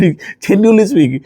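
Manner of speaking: drawn out very slowly through a laugh. Real, not acted. Vlogger repeating his own phrase to viewers, laughing. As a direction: savour the phrase, chuckling.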